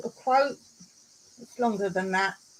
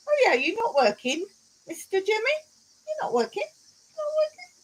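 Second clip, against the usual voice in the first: mock-incredulous questioning, climbing much higher; teasing disbelief; 10 semitones higher, faster than usual. Real, not acted. Streamer ribs a viewer for skiving off work, teasing disbelief.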